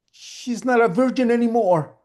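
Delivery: high, loud acted wail; distraught confession. Tearful mother revealing sister's secret, loud distraught wail. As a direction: blurt out the terrible news. Mother finally blurts shocking news.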